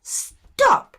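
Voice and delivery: shrill furious shout, stamping her foot; angry cry. A narrator's reading. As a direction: yell it to cut him off. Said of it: "cried Virginia, stamping her foot".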